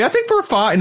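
high-pitched mock-polite refusal; polite brush-off. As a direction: imitate a dainty, courteous no thanks. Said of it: "just a very polite way".

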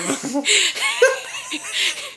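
high giggle; high laugh. Real, not acted. Laughs at own joke.

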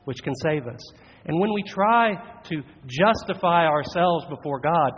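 ordinary delivery

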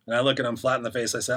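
normal talking voice

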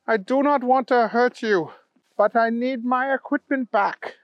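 high-pitched, pleading bunny voice; Kyle, earnest plea. Acted. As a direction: appeal to the rival in a high, even voice.

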